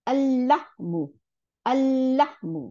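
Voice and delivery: "আল্লাহমু" with a long held L; teacher's model of "আল্লাহমু", L doubled. Shown as a demonstration. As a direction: draw out the L in "আল্লাহমু". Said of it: "দুইটা লাম বানাই দিল".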